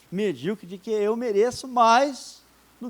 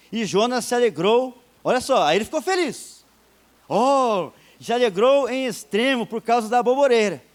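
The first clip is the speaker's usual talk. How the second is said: louder, proclaimed Bible reading; scripture reading; 5 dB louder than usual. Acted. Preacher reads the Bible to the church, proclaimed. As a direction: read the verse out to the church.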